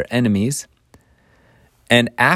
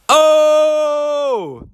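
long, high mic-drop "ohhh"; high whoop trailing off; 3 dB louder than usual. Pretend. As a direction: crow a high, drawn-out reaction. Speaker reacts to the reveal.

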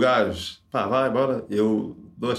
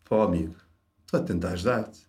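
softer, patronising calm; condescending; 6 semitones lower, 4 dB quieter than usual. Retold or imitated. Examiner patronises the failing student, softly condescending. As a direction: talk down to him gently. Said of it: "aquele tom meio condescendente".

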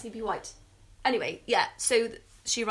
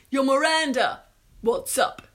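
loud, higher put-on voice; movie quote impression; 4 semitones higher, 8 dB louder, slower than usual. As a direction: call out like the film character. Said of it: "here's my impressions round".

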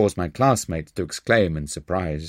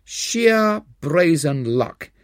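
thundering; 8 semitones higher, much slower than usual. Acted. Professor caught out by the trick, thundering slowly. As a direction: thunder it out, drawn long. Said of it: "he now thundered".

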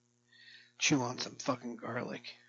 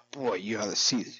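normal talking voice

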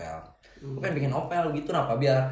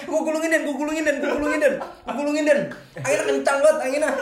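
shouting over wind; 10 semitones higher, faster than usual. Real, not acted. Strong wind, yelling across beach.

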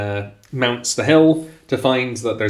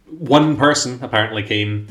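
ordinary delivery

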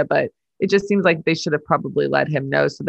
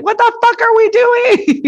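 much higher, louder and slow exasperated yell; pretend yell; 5 dB louder than usual. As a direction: let it all out at coworkers. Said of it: "wouldn't we all like to just yell that".

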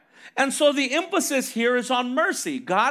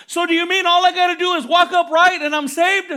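higher, louder mock-naive question; incredulous question; 5 semitones higher, 5 dB louder than usual. Preacher voicing someone who takes scripture literally, incredulous. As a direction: push it up loud, as a literal-minded believer.

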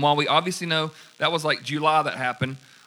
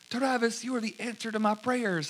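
Amy's grateful voice; 7 semitones higher, 5 dB quieter than usual. Retold or imitated. Amy voiced.

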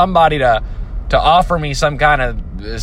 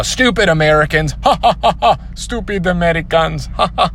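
a bad, hammed-up Chinese accent with a mocking laugh; horrible Chinese accent; 4 semitones higher than usual. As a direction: sneer it in a clumsy mock Chinese accent, then laugh. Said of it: "That was a horrible Chinese accent".

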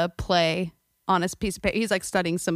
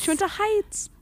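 mock echo; 13 semitones higher than usual. Echoes line in put-on voice.

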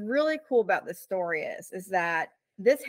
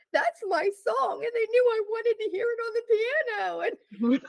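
high, slowed voice, choked with emotion; emotional. Retold or imitated. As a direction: let it catch as you relive the moment. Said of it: "I started getting emotional".

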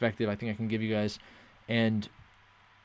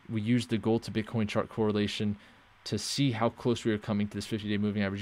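ordinary talk, nothing marked

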